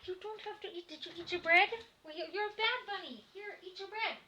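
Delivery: high cooing voice for a pet rabbit; baby talk to a bunny. Acted. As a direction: scold the bunny sweetly, high up.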